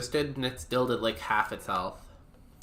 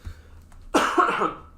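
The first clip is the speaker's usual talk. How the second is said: sudden harsh coughing fit; coughing up water; 9 semitones higher, 5 dB louder than usual. Real, not acted. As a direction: cough hard, choking on water. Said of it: "Water went down the wrong way".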